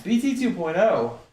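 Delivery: ordinary delivery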